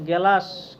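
গেলাস said slowly, with an added e between g and l; vowel inserted. Shown as a demonstration. Teacher shows how some say glass: গেলাস, with an extra vowel. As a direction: break the cluster: গেলাস, ge-las. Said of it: "কেউ কেউ... বলবে যে".

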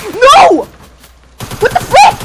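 real high, loud yelp of alarm; alarmed yell. Real, not acted. Player suddenly under attack in game, alarmed yell.

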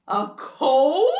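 loud question into a high gasp; happy astonishment. Acted. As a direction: react with overjoyed acted disbelief. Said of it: "He got so happy".

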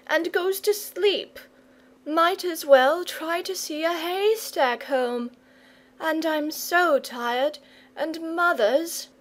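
high-pitched, whining, tearful complaint; tearful boy. A narrator's reading. Tired boy to Saint George, tearful complaint. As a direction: complain as if close to breaking down. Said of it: "sobbed the boy".

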